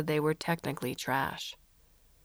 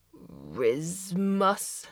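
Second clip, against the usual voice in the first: alien voice. Acted. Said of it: "the alien asked".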